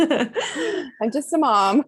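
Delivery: slow words through laughter; laughing. Real, not acted. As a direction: laugh as you say it. Joking, laughing at herself.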